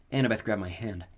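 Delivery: usual speaking voice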